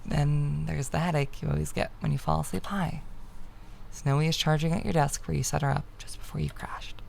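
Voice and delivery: ordinary delivery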